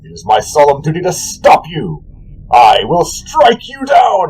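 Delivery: slow, solemn warrior vow ending in a shout; a holy knight promising to strike down the god; vowing paladin. Acted. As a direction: pronounce his doom slowly, rising to a battle cry. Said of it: "and says".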